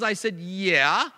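hesitant, drawn-out yeah, unsure of himself; unconfident yeah. Retold or imitated. Man retelling his reluctant answer to Billy Graham, hesitant and unsure. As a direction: say it slowly, without conviction. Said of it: "he didn't say it confidently".

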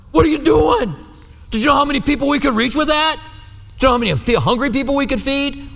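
high-pitched, incredulous objection; acting out outraged disbelief at the waste; indignant protest. Voicing indignant onlookers at waste.

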